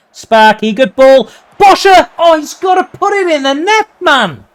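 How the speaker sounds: very high, loud yell at the open goal; excited shout. Real, not acted. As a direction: scream the name, then wail the miss. Big chance missed.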